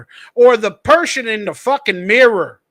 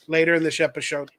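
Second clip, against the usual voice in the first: usual speaking voice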